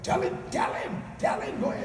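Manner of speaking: a wife shouting behind the caller, egging him on; urging wife. Retold or imitated.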